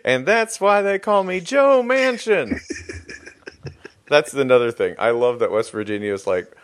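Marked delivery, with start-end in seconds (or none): 0.0-2.6 high, drawn-out punchline through laughter; high-pitched comic catchphrase; mock folksy sign-off. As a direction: crow it slowly, cracking up.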